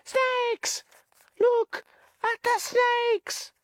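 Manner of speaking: put-on very high, childish alarm; little boys crying out in fright; mock screams. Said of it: "The little boys screamed at them".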